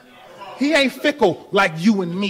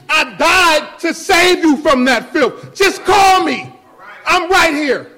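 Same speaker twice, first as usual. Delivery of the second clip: loud, slow, pleading call; Jesus calling out loud; 8 semitones higher, 8 dB louder, slower than usual. Acted. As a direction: shout the invitation to come back. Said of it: "he's still waiting".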